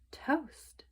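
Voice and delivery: ordinary delivery, nothing marked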